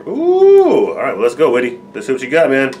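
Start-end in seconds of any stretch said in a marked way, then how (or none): 0.1-0.9 very high, loud hype "ooh"; excited ooh. Real, not acted. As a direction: get pumped as the track drops. Hyped as the song starts.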